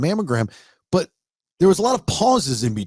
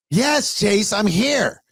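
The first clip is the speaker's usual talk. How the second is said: high-pitched, dragged-out mock slur; slurring mother; 6 semitones higher, much slower than usual. Mother answering her son on the phone, slurring. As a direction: imitate a woozy mother, pitched well up. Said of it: "She's slurring her speech".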